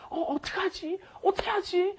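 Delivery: high, breathy-soft dismay like a drama heroine; drama heroine panicking. Retold or imitated. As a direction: wail it like a flustered drama character. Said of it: "You'll hear this all the time".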